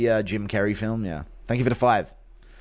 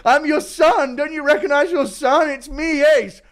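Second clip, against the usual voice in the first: much higher and louder, begging to be known; high desperate cry of a son; desperate pleading son; 12 semitones higher, 6 dB louder than usual. Pretend.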